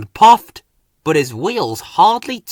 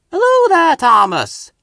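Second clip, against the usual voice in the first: higher, louder, a cheery whistle of a hello; whistled greeting; 7 dB louder than usual. A narrator's reading. Big engine greets Thomas with a high whistle. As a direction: sing out the greeting like a train whistle. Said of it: "whistled Hank".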